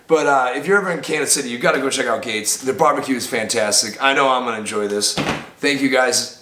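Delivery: ordinary talk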